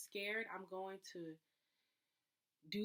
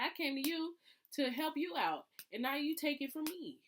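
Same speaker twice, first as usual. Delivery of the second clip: higher, louder aggrieved complaint; wounded whine; 8 semitones higher, 7 dB louder than usual. Pretend. To the car inspection that took her car away, higher aggrieved whine. Acting hurt protest.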